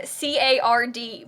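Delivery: spelled slowly letter by letter, C A R D. Shown as a demonstration. Streamer spells the word for chat, slow and deliberate. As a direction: spell "card" out slowly, each letter apart. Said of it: "just to clarify".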